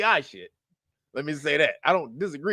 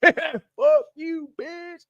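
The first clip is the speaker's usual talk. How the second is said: high, mocking yell of angry fans; mock MAGA outrage; 11 semitones higher, much slower than usual. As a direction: squawk it like furious supporters. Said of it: "They were like".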